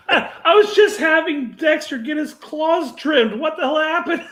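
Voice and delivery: high, loud hammed-up disbelief through laughter; mock shocked outburst. Co-host plays owner told Dexter is dead, mock shock. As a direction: protest like a stunned pet owner, half laughing.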